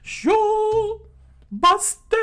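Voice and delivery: loud, very high falsetto; hammed-up squealed battle cry; attack shout. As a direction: yell each word long and separately. Fighter calls out attack while kicking.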